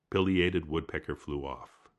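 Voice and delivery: ordinary delivery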